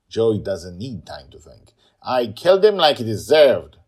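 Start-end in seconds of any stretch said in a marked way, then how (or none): 2.0-3.8 put-on loud defiant child. Girl defending herself to aunt, loud defiant. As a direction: shout it back without regret.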